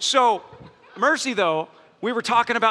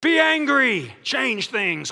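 mock activist slogan; much slower than usual. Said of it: "a biblical rendition of what fretting looks like".

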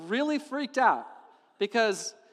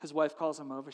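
ordinary talk

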